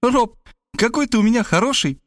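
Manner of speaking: put-on high, joyful girlish squeal. A narrator's reading. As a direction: squeal it in delight. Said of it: "завизжала от радости".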